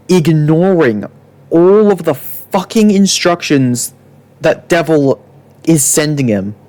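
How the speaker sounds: ordinary talk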